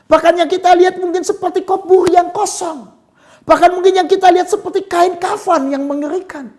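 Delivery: high, loud preaching at full force; soaring pulpit voice, proclaiming; fiery preaching. Acted. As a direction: preach it out with rising fire.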